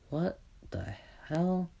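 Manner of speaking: slow, soft whisper of disbelief; stunned whisper. Acted. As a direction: whisper it slowly, dumbfounded. Izuku stunned at the newcomer.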